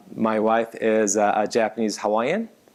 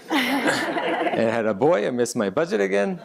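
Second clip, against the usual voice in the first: laughing; 9 semitones higher than usual. Real, not acted. Telling the audience about his kids, laughing. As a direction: chuckle through it at your own joke. Laughing through the family story.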